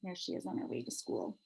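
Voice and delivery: whispered aside. Real, not acted. Parent pointing at picture, whispering to child.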